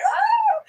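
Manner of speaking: high mock shriek of fright; startled yelp. Husband grabbed by a stranger in line, imitated high shriek. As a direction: imitate a grown man's startled squeal.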